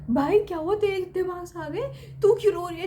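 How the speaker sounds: why is she crying with those marks; surprised concern. Retold or imitated. Asking her crying friend.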